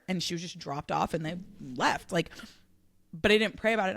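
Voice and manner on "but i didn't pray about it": ordinary talk, nothing marked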